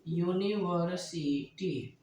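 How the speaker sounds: University drawn out slowly, syllable by syllable; slow dictation. Shown as a demonstration. Teacher dictating an example sentence to students, slow and drawn out. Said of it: "That is dot dot".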